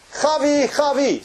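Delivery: high, loud call across a crowd; excited shout of a friend's name. Retold or imitated. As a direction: call her name from a distance. Friend calls her across street.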